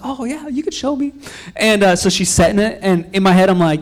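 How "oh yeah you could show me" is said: high, soft put-on innocence; playing dumb. Pretend novice letting the instructor help, mock meek. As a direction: meekly feign ignorance, pitched up.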